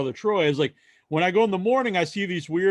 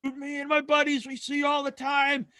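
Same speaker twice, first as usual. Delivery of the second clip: put-on excited drunk fan; 10 semitones higher than usual. Drunk fan recognizing the local walker, high excited gushing. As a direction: gush like a tipsy frat boy spotting a celebrity. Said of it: "He's like".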